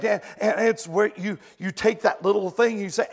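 normal talking voice

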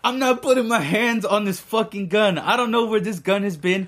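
high-pitched retold refusal; retold protest. Retold refusal to touch gun.

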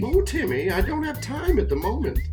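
lightly voiced fluttery lady; flustered high lady voice; high-pitched woman voice. Hank B character answers Timmy.

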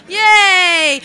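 loud sarcastic yay; hammed-up yay; mock cheer. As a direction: cheer it with fake pride. Mock celebrating not racist.

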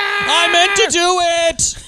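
slow, high wailed confession; hammed-up hysterical shriek; mock screaming. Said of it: "fucked it up by screaming so much".

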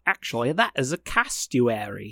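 soft, lightly voiced Australian accent; a quiet hint of Australian accent. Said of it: "He's like".

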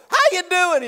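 high loud cheerful greeting; cheery greeting. Retold or imitated. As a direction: greet mourners with glee. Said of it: "walk up and go".

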